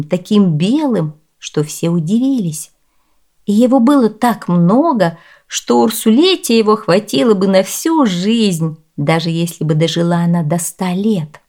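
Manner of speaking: ordinary delivery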